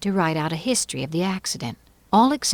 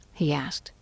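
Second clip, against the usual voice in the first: usual speaking voice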